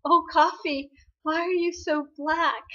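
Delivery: high-pitched mock lament to the coffee; weakly chuckling. Woman talking to her coffee, weakly chuckling. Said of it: "weakly chuckling, she says".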